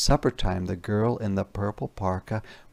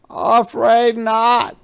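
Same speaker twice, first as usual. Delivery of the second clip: high, loud, slow truck character voice; a big friendly truck, sorry and apologetic; Yellow Truck's voice; 12 semitones higher, 10 dB louder, slower than usual. Acted. Yellow Truck apologises to Little Red in a high, loud voice. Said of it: "said Yellow Truck".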